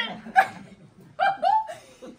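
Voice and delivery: shrill burst of laughter; high laugh. Acted. Storyteller cracking up at her own tale.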